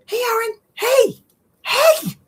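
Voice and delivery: very high, loud repeated hails; calling out. Real, not acted. Still trying to get the friend's attention, high loud calls.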